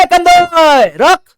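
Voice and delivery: very high, loud, drawn-out call to stop; shouting owner. Retold or imitated. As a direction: yell after him from the back of the shop. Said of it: "वो मालिक चिल्लाया".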